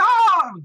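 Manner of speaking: a sudden high shriek of alarm; a mother's shrill "Oh!" when she learns her son is there. Retold or imitated. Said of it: "She just went crazy".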